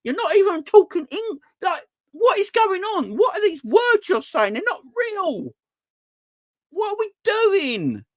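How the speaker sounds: high, strained disbelief; shrill outrage at what he hears; incredulous rant. Retold or imitated. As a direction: viewer fuming at the TV pundits. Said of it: "I'm like".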